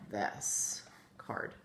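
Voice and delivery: card whispered, er sound held long and slow; whispered model word. Shown as a demonstration. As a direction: breathe out card slowly. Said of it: "the way it's supposed to be written".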